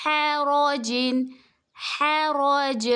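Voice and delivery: حرجن slow, heavy ر, then حرج with a jolt on ج; heavy ra with qalqalah. Shown as a demonstration. Teacher showing heavy ra and qalqalah in حرج. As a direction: make ر heavy and bounce the ج in حرج. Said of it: "جیم میں قلقلہ کی آواز نکالیں گے".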